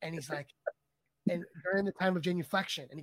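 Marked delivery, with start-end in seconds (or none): none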